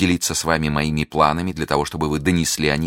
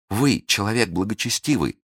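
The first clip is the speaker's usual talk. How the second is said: higher, scornful accusation; high bitter reproach; indignant reproach; 5 semitones higher than usual. Acted. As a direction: shame him for his cowardice. Milady reproaches Felton indignantly.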